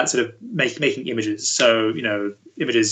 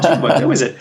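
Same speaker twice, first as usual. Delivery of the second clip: the question breaking into a laugh, higher; laughing mock question; 4 semitones higher, 5 dB louder than usual. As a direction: tease the student while laughing. Teacher quizzing student, laughing.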